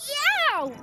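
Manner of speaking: sudden high, loud yelp; shout. A narrator's reading. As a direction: yelp in fright as the lift falls. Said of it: "he shouted".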